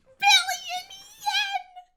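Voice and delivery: high, loud, very stretched squeal; astonished. Real, not acted. Viewer reacting to a billion yen, astonished squeal. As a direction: draw the words out in a strained, high cry.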